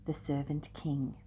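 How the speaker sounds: ordinary delivery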